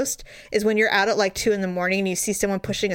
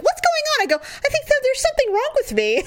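mock panic; 14 semitones higher than usual. Retold panic on the hill.

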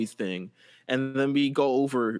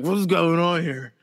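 put-on slurring drunk; 5 semitones higher, 4 dB louder than usual. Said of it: "why is he slurring his words".

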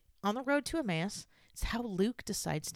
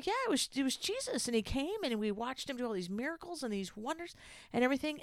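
higher, excited telling of the news; voicing the disciples, pitched up; eager disciples; 8 semitones higher than usual. Disciples retell Jesus's story.

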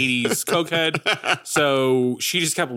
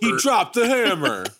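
imitating Michael Rooker; 8 semitones higher, slower than usual. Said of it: "Michael Rooker".

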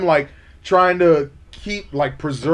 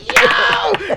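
excited; 3 dB louder than usual. Retold or imitated. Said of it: "I was like".

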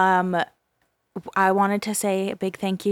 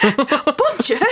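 mock French bonjour; 4 semitones higher, 6 dB louder than usual. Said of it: "doesn't have a British accent".

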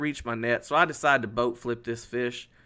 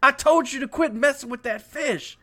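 high-pitched, indignant telling-off; outraged retold scolding; 11 semitones higher, 3 dB louder than usual. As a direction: re-enact scolding your brother in disbelief. Acted quarrel with his brother.

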